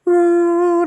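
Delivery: high, loud imitated sob-wail; mock wail of a weeping wife; mock crying wail. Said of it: "nangis gitu kan".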